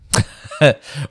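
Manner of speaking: short, high, loud laugh. Real, not acted. Laughing to a friend about the backpack choice. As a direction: break into a laugh mid-question.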